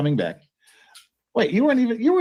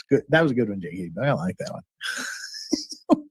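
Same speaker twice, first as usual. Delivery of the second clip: usual speaking voice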